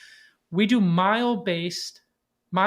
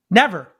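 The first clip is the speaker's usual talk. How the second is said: never punched loud and high; stress on never; 4 semitones higher, 9 dB louder than usual. Real, not acted. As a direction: hit the word never hard. Emphatic repetition of never.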